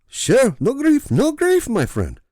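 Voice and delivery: put-on high slow panicked Middle Eastern accent.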